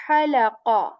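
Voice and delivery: halaqa begun with breath pushed from mid throat; halaqa with airy ha. Shown as a demonstration. Teacher contrasting the airy word. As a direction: push air first, then halaqa. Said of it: "this ha from the middle portion".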